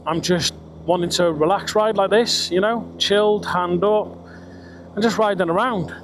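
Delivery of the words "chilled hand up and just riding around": ordinary delivery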